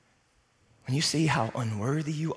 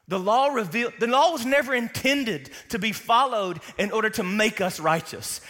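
higher, louder pulpit proclamation; preaching at full force; 7 semitones higher, 5 dB louder than usual. Acted. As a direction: proclaim it to the congregation.

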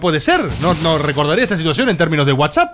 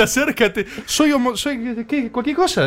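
imitated drunk stammer, higher and softer; drunken babbling; 5 semitones higher, 4 dB quieter, slower than usual. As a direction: fumble a mock confession like a drunk. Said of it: "imagínate el balbuceo".

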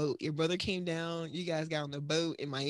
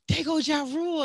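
excited announcing; 8 semitones higher, 4 dB louder than usual. Retold or imitated. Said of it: "Aaron calls me and was like".

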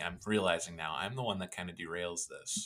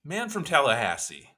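higher and louder announcer read; title announcement; 7 semitones higher, 7 dB louder than usual. Acted.

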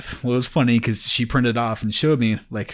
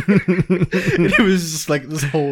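laughing; 7 semitones higher, 5 dB louder, slower than usual. Real, not acted. Laughing through awkward story.

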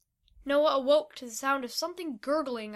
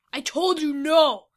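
loud, slow, drawn-out yell; angry refusal; 4 semitones higher, 11 dB louder, slower than usual. A narrator's reading. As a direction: yell it higher, stretching the words. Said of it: "Vito spat in Noah's face".

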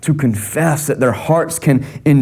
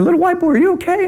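high, fast mock voice; 13 semitones higher, faster than usual. Big Keith quoted.

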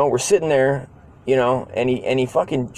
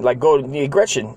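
usual speaking voice

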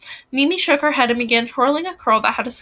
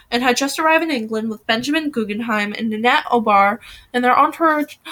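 plain reading voice

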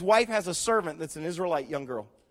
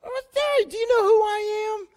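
much higher, mock indignant squeal; offended big shot; 13 semitones higher, slower than usual. Naaman mocked, insulted that Elisha won't come out, high squeal. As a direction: play the insulted VIP, whining up high.

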